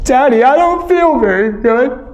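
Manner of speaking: put-on a queasy kid about to be sick; sick little girl. Daughter sits up sick.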